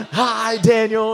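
slow, bright mock wave hello; drawn-out friendly hi from a passing car; cheery greeting. Friend in next car greeting pastor, slow cheery hello. [name] waving from his car.

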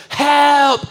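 high, loud mock cry of help. Peter sinking in the storm shouts for help, high and loud. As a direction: call out like a sinking man.

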